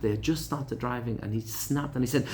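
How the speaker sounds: normal talking voice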